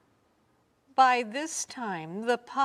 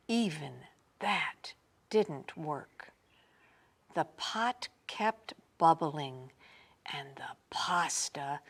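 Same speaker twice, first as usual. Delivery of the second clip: whisper; 5 semitones lower, 6 dB quieter than usual. A narrator's reading. Hushed suspense narration.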